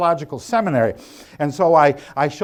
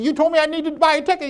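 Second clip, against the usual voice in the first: higher, fast incredulous complaint; retelling his own panicked objection at a higher pitch; exasperated protest; 12 semitones higher, faster than usual. Retold or imitated. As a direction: protest in a rush, voice jumping high. Retold exasperated protest to friend.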